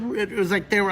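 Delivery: normal talking voice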